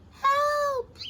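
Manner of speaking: cry for help. Acted. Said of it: "he groaned feebly".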